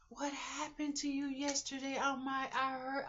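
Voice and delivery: a hint of a worried neighbour; concerned lady voice. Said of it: "the lady said".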